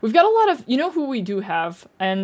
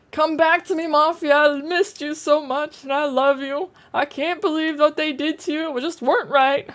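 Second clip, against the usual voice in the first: joyful reunion; 6 semitones higher than usual. Pretend. Addressing item in game tenderly.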